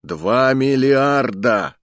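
loud, very slow, falling on миллиарда; emphatic slow repeat. A narrator's reading. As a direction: hammer each word of Два миллиарда. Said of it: "громогласно произнёс наставник".

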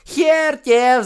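очень высоко, громко и медленно, издеваясь; выкрикни с издёвкой как мелкий хулиган; насмешливый детский выкрик. Acted. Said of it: "насмешливо". (насмешливый детский выкрик)

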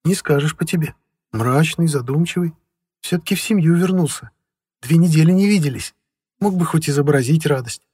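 a wife pressing her husband, concerned; worried wife. A narrator's reading. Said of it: "Беспокойно посмотрела".

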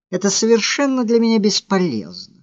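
high, quick, cutting dismissal; dry, hurtful. A narrator's reading. Woman tells her admirer his leap is useless, dryly wounding. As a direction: toss it off fast and dry, pitched high. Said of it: "сказала она сухо и как-то особенно обидно".

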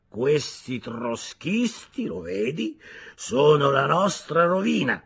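very slow, pompous pronouncement; weighty, drawn-out moralizing; slow and sententious. A narrator's reading. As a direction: lecture him like a drunk preacher. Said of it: "lento e sentenzioso".

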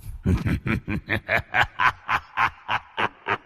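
soft eerie villain laugh; evil laugh. Acted. The villain gloating over his prey, a soft evil laugh. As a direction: let a quiet sinister chuckle roll out.

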